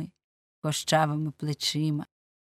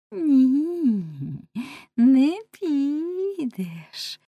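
higher, very drawn-out singsong refusal; teasing girl; 8 semitones higher, 3 dB louder, much slower than usual. Acted. Girl refusing to let man go, teasing singsong drawl. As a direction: stretch each vowel, loud and lilting.

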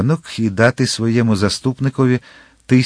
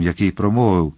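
ordinary delivery, nothing marked

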